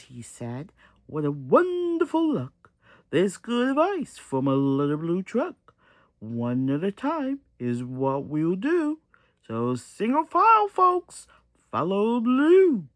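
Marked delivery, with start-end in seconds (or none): 1.1-12.9 loud booming orator voice; speechify to the crowd; mayor addressing traffic. Acted. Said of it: "the way mayors do".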